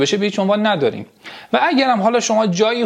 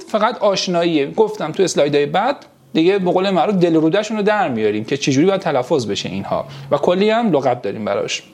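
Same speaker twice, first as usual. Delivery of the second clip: ordinary talk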